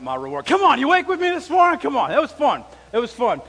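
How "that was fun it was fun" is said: ordinary delivery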